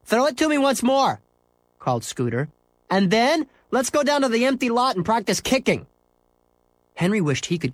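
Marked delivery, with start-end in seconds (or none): 0.0-1.2 put-on high, bright kid call; boy calling out. Scooter calls across the street, high boy's voice. 2.9-5.9 put-on high, bright kid call; boy calling out. Scooter calls across the street, high boy's voice.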